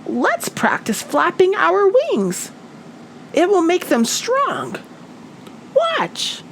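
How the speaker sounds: a tiny bird squeaking with excitement; Fluff the bird. A narrator's reading. Fluff urging her friends, high bird voice. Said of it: "Fluff said".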